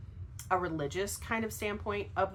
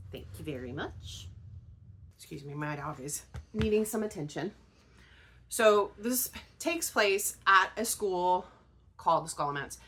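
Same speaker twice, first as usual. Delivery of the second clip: ordinary delivery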